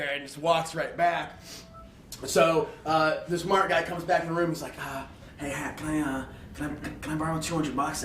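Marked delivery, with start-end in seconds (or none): 4.8-8.0 much softer, hesitant asking; sheepish quiet plea; timid mumbled request. Retold or imitated. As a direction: shyly ask for cash. Timid kid asking for money.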